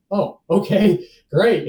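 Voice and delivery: high, loud, upbeat acceptance; acted cheery relief. Hearing his fingers are still there, acted cheery relief. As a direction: overplay the relief brightly.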